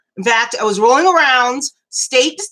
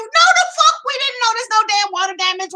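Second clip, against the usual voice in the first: much higher, fast, outraged comeback; indignant retort; 8 semitones higher, faster than usual. Retold or imitated. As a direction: fire it back at the salesman.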